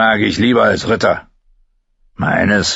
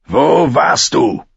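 slow, louder, drawn-out threat; menacing demand; 6 semitones higher, 4 dB louder, slower than usual. Acted. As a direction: lean in and demand it slowly. Bluthund fordert Antwort drohend. (langsam und drohend gefragt)

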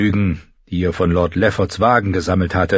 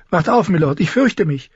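high, hushed-sounding alarm voice; mildly frightened, raised character voice; frightened urging; 9 semitones higher than usual. Acted. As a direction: urge a sleeper awake, voice pitched up. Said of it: "flüsterte sie".

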